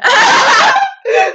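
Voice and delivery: high loud burst of laughter. Real, not acted. Sister teased about saying te amo, loud high laugh.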